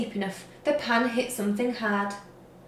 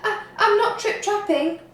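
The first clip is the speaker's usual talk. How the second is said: higher, stammering timid little voice; very small voice; 9 semitones higher than usual. A narrator's reading. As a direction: shrink and stutter like a scared troll. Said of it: "said the Troll in a very small voice".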